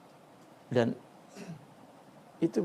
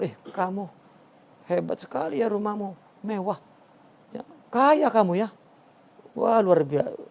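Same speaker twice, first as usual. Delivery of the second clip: higher, mock-admiring teasing; sarcastic praise; 5 semitones higher than usual. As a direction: flatter him with a sneer underneath.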